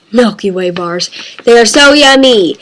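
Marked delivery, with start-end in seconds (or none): 0.1-1.1 softer, low, quicker aside; quiet repeat. Real, not acted. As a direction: say it low to yourself. Same answer repeated as soft aside.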